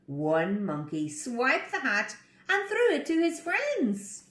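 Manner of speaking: usual speaking voice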